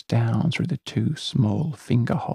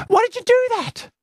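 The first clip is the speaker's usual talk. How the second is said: very high angry shriek; upset girl; 5 dB louder, faster than usual. Acted. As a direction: accuse your friend. Celia furious.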